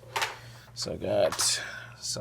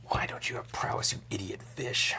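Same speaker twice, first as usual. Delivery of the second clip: whispering; 4 dB quieter than usual. Real, not acted. Muttered taunt at opponent.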